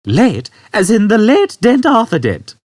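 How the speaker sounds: a mild threat, lightly stern; sternly. A narrator's reading. Old man threatening Arthur, high and mildly stern. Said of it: "said the man sternly".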